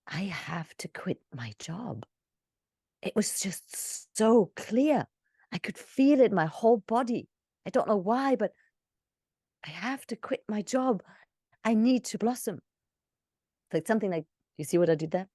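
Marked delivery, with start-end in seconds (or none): 0.1-2.0 slow, hushed realization; awed whisper. Shown as a demonstration. Coach showing the wow moment, awed whisper. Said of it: "I'm giving you the whole wow experience". 3.0-12.6 higher, slowed awe; awed realization. Shown as a demonstration. Coach demonstrating emotion, awed higher voice. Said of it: "I'm giving you the whole wow experience".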